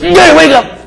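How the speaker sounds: sudden high-pitched mock yell; imitated toddler yell. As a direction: yelp it like an excited little granddaughter. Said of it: "she yells at me".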